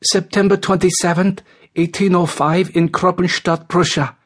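high, level recital; obedient young man. A narrator's reading. George giving his birth details to the officer, obedient.

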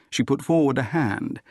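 usual speaking voice

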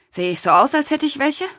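a smug man scoffing at the question; mocking retort. A narrator's reading. The patient scoffs at the doctor's question, mocking and rising. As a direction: pitch climbing high into a scornful question.

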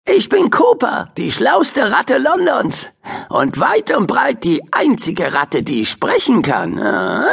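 high boastful rat voice; high cheeky rat bragging. Acted. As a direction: brag like the cleverest rat in London. Rat introduces itself.

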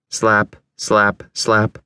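usual speaking voice

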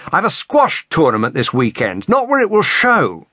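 indignant complaint climbing high; exasperated snap. A narrator's reading. Man worried about marks before tournament, exasperated snap. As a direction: protest testily, rising sharply at the end. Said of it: "Warwick snapped".

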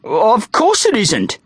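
high, stretched-out indignant denial; astonished. A narrator's reading. Bully denying that the worm is blood, astonished. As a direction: deny it in drawn-out, high-pitched astonishment.

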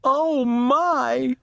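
very high, dismayed little voice; alarmed little sister. A narrator's reading. Baby watches her sister run out, high dismay. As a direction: exclaim in dismay, pitched right up.

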